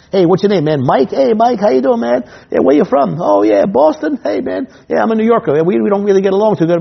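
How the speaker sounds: high, bright chummy host chatter; friendly host banter. Retold or imitated. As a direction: sweet-talk the heckler to keep him off stage. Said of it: "head him off the pass".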